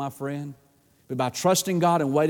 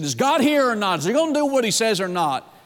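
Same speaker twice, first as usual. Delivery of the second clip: higher-pitched mock complaint; complaining; 6 semitones higher, 3 dB louder than usual. Preacher voices Israel in temptation, complaining in disbelief. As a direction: demand an answer, acting out the doubters. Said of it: "began to complain and cry and say".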